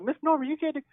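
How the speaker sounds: high-pitched mock pleading; retold protest. Speaker retells objecting to Miss Norma, high mock protest. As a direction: imitate an alarmed protest, pitched well up.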